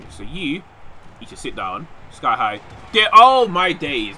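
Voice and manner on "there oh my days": loud, drawn-out, higher exclamation; excited outburst. Real, not acted. Gamer reacting to a wrestling move, excited outburst. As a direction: draw it out loudly in amazement.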